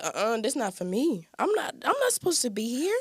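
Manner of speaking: high, pleading cry of disbelief; imitating her tearful self; retold crying protest. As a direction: sob it out that this can't be happening. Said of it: "I started crying 'cause I said".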